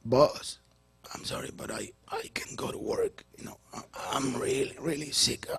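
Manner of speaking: soft, half-whispered fake sick plea to the boss; faking sick voice. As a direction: sound pitifully ill to get off work. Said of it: "yo estudié drama".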